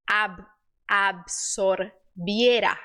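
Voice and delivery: "absorbiera" broken slowly into syllables, low pitch; slow, careful "absorbiera", pausing after "Ab-". Shown as a demonstration. Teacher helping with a difficult word, syllable-by-syllable "absorbiera". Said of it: "Absorbiera é um pouquinho difícil".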